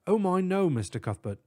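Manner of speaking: high emphatic British professor; British accent. Acted. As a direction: declare it, very English. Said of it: "Tutwiler declared".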